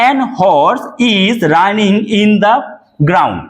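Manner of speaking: each word of the sentence drawn out very slowly; slow dictation. Shown as a demonstration. Teacher reads the faulty sentence slowly to students. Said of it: "দেখে নাও".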